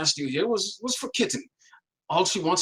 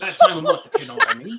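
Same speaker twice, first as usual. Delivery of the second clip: higher, louder, laughing through it; laughing innuendo; 6 dB louder, faster than usual. Acted. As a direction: giggle out the innuendo. Cat leers, laughing.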